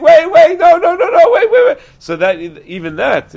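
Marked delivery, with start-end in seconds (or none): 0.0-1.8 high, loud panicked yells of wait; frantic screaming. Acted. Husband chasing the messenger, screaming frantically. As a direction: scream after the messenger to stop. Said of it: "He's just screaming".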